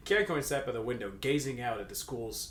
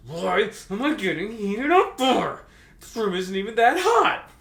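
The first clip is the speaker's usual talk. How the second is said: flustered character voice; 9 semitones higher, 11 dB louder, slower than usual. Acted. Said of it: "Kakyoin said to himself".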